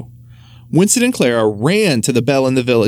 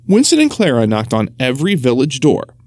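plain reading voice

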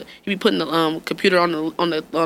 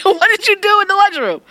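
exasperated mom; 13 semitones higher, 5 dB louder than usual. Retold or imitated. Mother quizzing son.

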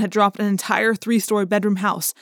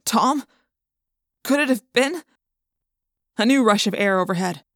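ordinary delivery

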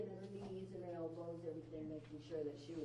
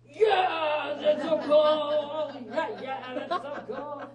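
much louder and higher, bursting with joy; excited shout; 7 semitones higher, 19 dB louder, much slower than usual. Real, not acted. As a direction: yell it out in a giddy rush, laughing at the end. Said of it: "I get all excited".

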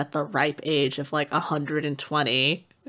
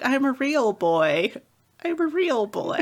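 high, plaintive insisting voice; pleading spirit boy; 7 semitones higher than usual. Retold or imitated. Spirit boy Cole insisting he is real, in a high plaintive voice. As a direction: plead to be believed, climbing higher. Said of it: "'cause he was like".

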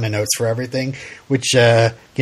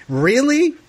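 incredulous dude; 9 semitones higher, slower than usual. Retold or imitated. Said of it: "it's just like".

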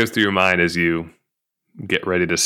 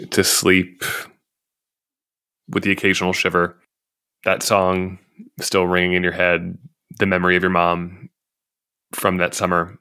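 sleep story narrator. Acted. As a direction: lull them gently toward sleep.

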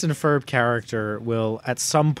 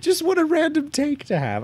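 much higher, incredulous squeak; 13 semitones higher than usual. Real, not acted. Host reacting to a random crush, high incredulous. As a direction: disbelief at a wild opinion. Reacting to [name] claim.

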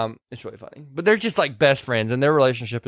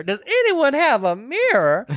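much higher, drawn-out request; escalated plea; 10 semitones higher, slower than usual. Retold or imitated. Retelling the woman demanding a mirror, high drawn-out plea. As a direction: stretch it out high, theatrically.

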